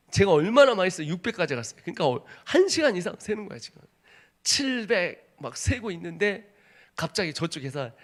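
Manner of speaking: ordinary talk, nothing marked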